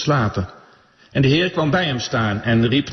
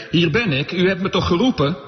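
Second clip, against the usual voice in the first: lightly voiced eager boy, pitched higher; young Samuel's question; 7 semitones higher than usual. A narrator's reading. Samuel runs to Eli thinking he called, lightly voiced questioning boy. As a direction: read the boy's question higher, lightly acted. Samuel's line in read scripture.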